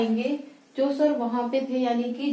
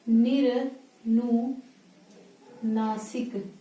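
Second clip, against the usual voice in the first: slowly spelled out; much slower than usual. Shown as a demonstration. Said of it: "teacher drawing out the term".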